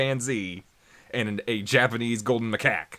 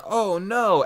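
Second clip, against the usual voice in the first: high-pitched pretend horror; mock dismay; 9 semitones higher, 4 dB louder than usual. Friends seeing him fall into a ravine, mock dismay. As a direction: wail it in mock alarm. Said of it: "they're all going".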